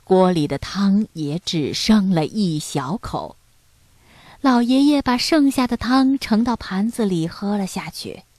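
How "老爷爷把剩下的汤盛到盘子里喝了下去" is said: ordinary delivery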